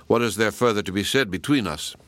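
ordinary delivery

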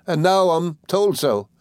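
high, slow self-pitying whine; lightly voiced widow, whimpering slowly; mild hammed-up whimper, high and slow; pitiable whimper. A narrator's reading. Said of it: "with a pitiable whimper".

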